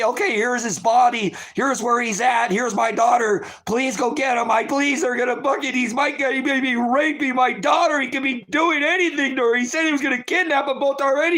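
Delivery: high, pleading, racing to get the words out; frantic plea. Retold or imitated. Mother retelling her frantic plea to police. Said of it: "when I sent them to go say".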